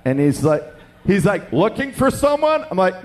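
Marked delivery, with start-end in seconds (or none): 1.5-2.6 high-pitched, chirpy question; perky. Retold or imitated. Young businessman offering help to waiting diners, acted perky. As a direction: imitate an over-friendly stranger. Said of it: "just all perky-like".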